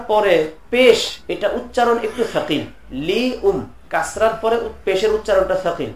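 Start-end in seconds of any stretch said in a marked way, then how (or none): none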